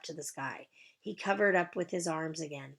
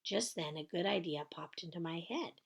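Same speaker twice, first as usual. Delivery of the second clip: ordinary delivery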